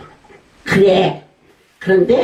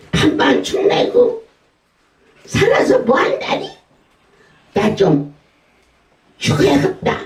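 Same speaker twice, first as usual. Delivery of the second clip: much higher, whining grandma voice with a laugh; whiny old mother; 8 semitones higher than usual. Retold or imitated. Old mother's constant complaint.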